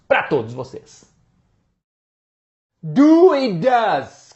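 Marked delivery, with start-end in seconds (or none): 2.8-4.3 stretched, louder title announcement; drawn-out intro title; slow title read. Shown as a demonstration. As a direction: announce the topic slowly. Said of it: "video title".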